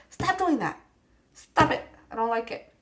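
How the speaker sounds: pretend-cross high protest; mock scolding. Scolding the card.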